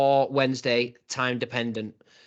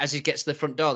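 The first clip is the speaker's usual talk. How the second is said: normal talking voice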